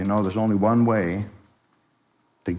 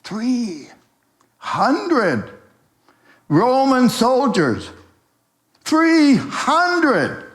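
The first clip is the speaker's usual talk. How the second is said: very high, drawn-out cry, building louder; alarmed report; 4 dB louder, much slower than usual. Acted. Lookout reporting soldiers outside to the gang, alarmed. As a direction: cry it out in high-pitched, stretched words.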